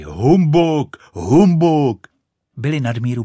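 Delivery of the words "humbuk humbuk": loud, high indignant cries; full-throated shouting. A narrator's reading. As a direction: shout it at the top of your lungs. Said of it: "z plna hrdla vykřikovali". (výkřiky z plna hrdla)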